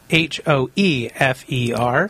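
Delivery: slow, letter by letter: H O E F E R; spelling it out. Shown as a demonstration. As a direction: spell H O E F E R one letter at a time. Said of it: "the last name is spelled".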